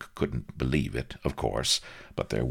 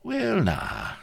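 high-pitched prim Cockney hedging. A narrator's reading. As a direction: begin primly, keeping out of it. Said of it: "said Vladimir primly".

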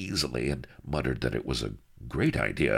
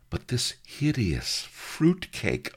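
high, slow horrified lady voice; 10 semitones higher, slower than usual. Acted. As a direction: gasp it out in disgust. Narrator voices horrified heroine.

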